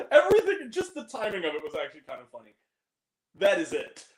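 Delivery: ordinary talk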